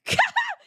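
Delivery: a sudden high shriek of laughter. Real, not acted. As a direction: burst into a squealing laugh. Laughs after "peak.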